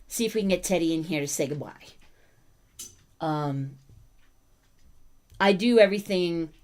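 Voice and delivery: normal talking voice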